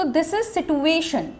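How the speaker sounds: situation drawn out very slowly, syllable by syllable; teacher stretching out situation; slow demo. Shown as a demonstration. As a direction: spell out situation slowly for students. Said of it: "इसका जो pronunciation है".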